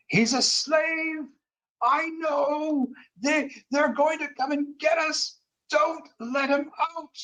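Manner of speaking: high-pitched, drawn-out acted scream. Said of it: "like, literally screaming".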